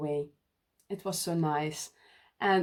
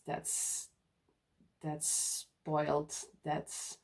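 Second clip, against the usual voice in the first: hushed aside; 3 semitones lower, 10 dB quieter, much slower than usual. Real, not acted. Aside about being spoiled.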